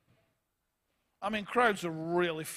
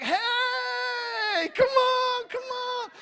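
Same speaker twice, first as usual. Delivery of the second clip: high shouted cheer of a sudden fan; excited crowd shout; 6 dB louder, much slower than usual. Retold or imitated. Fickle crowd calling to Bartimaeus, loud high cheering. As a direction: yell high and loud, all eager to help now. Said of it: "they're all like".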